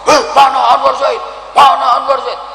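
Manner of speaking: high, quick calling out; searching call. Acted. Preacher voicing God searching for him. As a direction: look around and call the name. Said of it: "Allah terus saya".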